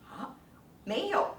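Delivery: ordinary talk, nothing marked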